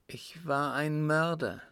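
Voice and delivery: forced-out confession. A narrator's reading. Father confessing murder to his son, with great effort. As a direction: push the words out against great inner resistance. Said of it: "wie mit Gewalt, mit aller Anstrengung herausgestoßen".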